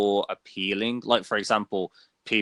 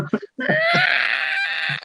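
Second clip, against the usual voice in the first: dinosaur shriek; 5 dB louder than usual. Retold or imitated. Imitates dinosaur sound again.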